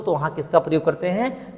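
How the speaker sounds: normal talking voice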